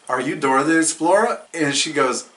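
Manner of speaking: usual speaking voice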